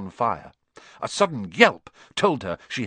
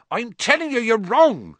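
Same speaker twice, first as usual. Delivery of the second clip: high, louder heated retort; hot denial; 13 semitones higher, 5 dB louder than usual. Acted. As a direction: snap back in heated defence. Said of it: "said Hagrid hotly".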